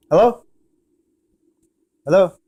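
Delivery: normal talking voice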